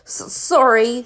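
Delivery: slow, stammered mock sob; sobbing sorry. A narrator's reading. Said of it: "sobbed Hagrid".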